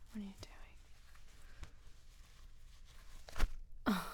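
soft, unvoiced pleading whisper; intimate whisper. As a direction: breathe the words close to the mic.

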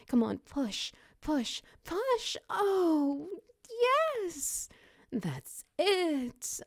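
high-pitched, loud cheering push; excited urging. Acted. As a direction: urge them on at the peak. Climax of the birth scene.

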